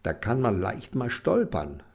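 usual speaking voice

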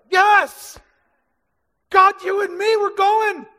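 loud, high triumphant shout; excited faith. Retold or imitated. Believer shouting bold faith at God. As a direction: cheer like you can beat anything. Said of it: "One day it's like".

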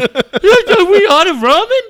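loud, high, drawn-out mock wail; hammed-up outburst over nothing; freaking out. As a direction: imitate a kid's meltdown, stretching each word. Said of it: "you freak out if she doesn't".

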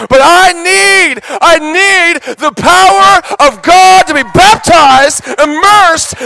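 high, loud, slow shouted preaching; impassioned altar-call shout; full-force preaching. Acted. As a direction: cry it out to the whole room.

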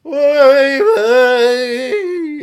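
loud Yiddish oy vey; Yiddish accent. Shown as a demonstration. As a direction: exclaim it with a Yiddish lilt. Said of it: "I say".

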